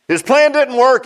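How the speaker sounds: loud, high preacher's repeat; repeated at full preaching force; plain. Acted. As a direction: belt the line out to the church. Repeated louder and higher.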